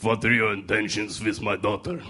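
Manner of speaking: a hint of a stern Russian father; lightly voiced protective dad grilling; gruff overprotective dad. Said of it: "I'll do my best impression".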